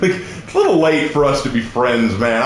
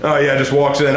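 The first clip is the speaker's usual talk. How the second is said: normal talking voice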